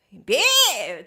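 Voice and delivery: loud, drawn-out mock screech; long noisy beep-like cry; imitated screech. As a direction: imitate a piercing pet squawk, stretched out. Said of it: "すごい声でね".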